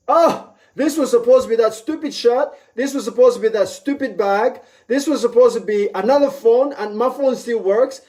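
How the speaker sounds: high-pitched, animated listing; mock exclaiming. Acts out gloating over money saved.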